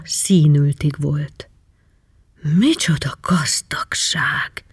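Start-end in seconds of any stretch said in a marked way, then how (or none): 2.6-4.6 slow, breathless whisper of amazement; awed whisper. A narrator's reading. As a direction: whisper it, staring at the treasure. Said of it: "hüledezett". (ámuldozó, lassú suttogás)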